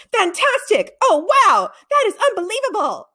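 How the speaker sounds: high, loud triumphant yell from across the room; excited shout. A narrator's reading. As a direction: shout with sheer delight. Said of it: "Jeff's voice shouting".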